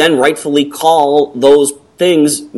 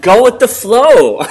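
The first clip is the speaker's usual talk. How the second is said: loud, high, drawn-out catchphrase; mock slogan; 9 semitones higher, 4 dB louder, slower than usual. Turning friend's phrase into a slogan, mock announcer. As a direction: proclaim it slowly like an ad slogan.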